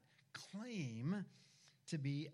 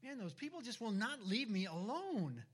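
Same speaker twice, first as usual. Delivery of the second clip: higher, louder exasperated complaint; mock upset Jesus; 9 semitones higher, 4 dB louder than usual. Preacher voicing Jesus, hammed-up and annoyed by the crowds. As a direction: whine like someone who wants to be left alone. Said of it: "was he upset?".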